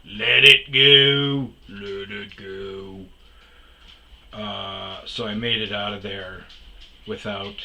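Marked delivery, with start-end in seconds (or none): 0.0-3.1 slow, drawn-out let it go swelling loud then falling; slow self-soothing mantra, one loud burst; calming mantra. Retold or imitated. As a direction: chant it to yourself to stay calm. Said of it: "The whole time I'm like".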